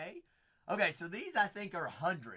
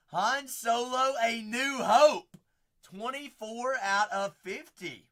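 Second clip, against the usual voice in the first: hyped callout; 6 semitones higher, 8 dB louder than usual. Acted.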